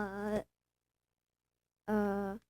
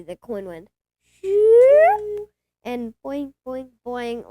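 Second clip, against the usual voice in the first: mock coin sound effects; 10 dB louder, slower than usual. Child acting out the lost coin to grandpa, playful noises.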